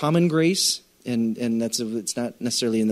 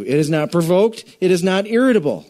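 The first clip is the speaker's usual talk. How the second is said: emphatic scripture reading; 4 semitones higher, 4 dB louder than usual. Acted. Reads list of love's traits.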